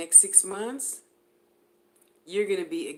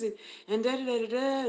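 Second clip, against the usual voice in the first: ordinary talk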